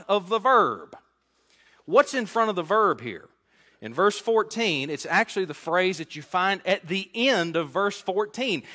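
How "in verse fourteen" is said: normal talking voice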